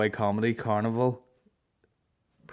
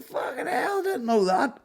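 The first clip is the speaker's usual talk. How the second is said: mocking the bar guy; slower than usual. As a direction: ham up a nosy punter spotting a comic. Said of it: "he's like".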